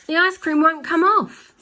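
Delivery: high, loud bellow. Acted. Husband bellows at the windscreen, high and loud. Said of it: "he bellowed".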